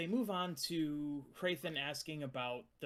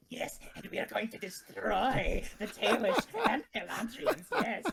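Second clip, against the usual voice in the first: high, loud, fawning servant voice; hunchback assistant; 7 semitones higher, 6 dB louder than usual. Acted. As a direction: grovel like Igor to his master. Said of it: "I picture him going".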